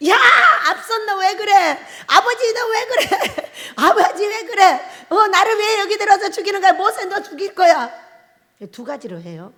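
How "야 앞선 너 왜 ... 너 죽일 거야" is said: higher, half-laughing mock grumbling at the leader; mock complaining crowd. Preacher imitating the Israelites blaming Moses, mock grumbling. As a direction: whine and accuse like a grumbling crowd, laughing through it. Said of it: "우리 이러잖아요".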